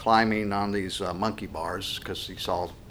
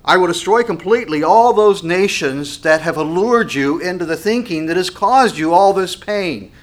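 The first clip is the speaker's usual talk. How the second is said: loud, raised-pitch declamation; forceful scripture preaching; 8 semitones higher, 11 dB louder than usual. Acted. Preacher declaring the Lord's promise to the people, forceful preaching. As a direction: thunder it out as God's promise. Reads the passage at full force.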